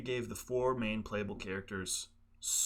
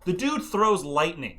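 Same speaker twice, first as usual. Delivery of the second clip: indignant protest; 5 semitones higher, 7 dB louder, slower than usual. Acted. Arguing against the developers' reasoning.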